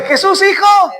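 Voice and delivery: high call for help; crying out. Acted. Blind man begging Jesus for help, crying out. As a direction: call out urgently, pitch well up. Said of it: "clamaba más".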